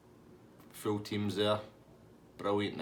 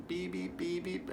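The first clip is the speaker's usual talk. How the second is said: high, softer electronic beeping; imitated buzzer beeps; 9 semitones higher, 5 dB quieter, slower than usual. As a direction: mimic buzzers being pressed repeatedly. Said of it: "pressing all the buzzers".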